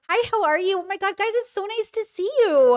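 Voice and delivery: high, gushing public greeting; effusive high customer-greeting voice. Acted. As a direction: put on a high-pitched social mask. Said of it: "get your public voice on".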